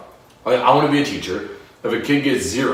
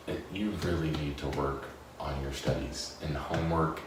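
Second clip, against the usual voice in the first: hushed teacher talk; 6 semitones lower, 13 dB quieter than usual. Retold or imitated. Teacher quiet private talk.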